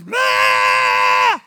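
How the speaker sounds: sudden high, loud mock tantrum yell; a father imitating his screaming three-year-old; daughter's tantrum scream. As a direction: shriek it like a raging toddler. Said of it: "She's screaming".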